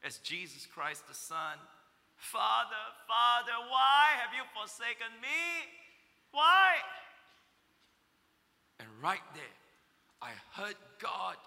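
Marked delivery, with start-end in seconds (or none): 2.2-6.8 loud, rising plea to the Father; anguished cry. Retold or imitated. Preacher reliving his own cry to God, anguished. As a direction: cry out why, breaking with grief. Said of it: "echoing the exact same sentiment".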